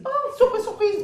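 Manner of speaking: high, loud exclamation of surprise; mock surprise. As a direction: squeal it like a staged big surprise. Acts out a mock surprised reaction. (surprise jouée, voix très aiguë et forte)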